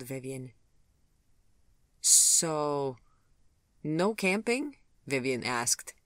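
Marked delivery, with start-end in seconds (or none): 2.0-4.8 gently mocking. A narrator's reading. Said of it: "gently mocking him".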